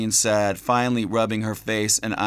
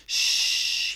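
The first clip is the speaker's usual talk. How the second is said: hushing. A narrator's reading. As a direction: gently hush a crying child. Father soothing crying child.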